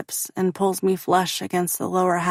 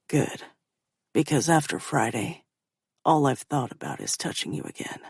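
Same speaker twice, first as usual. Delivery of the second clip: low male voice; 5 semitones lower than usual. A narrator's reading.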